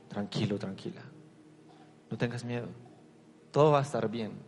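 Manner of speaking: quiet tender reassurance; tender comfort. Retold or imitated. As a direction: soothe them gently. Jesus comforting the listener.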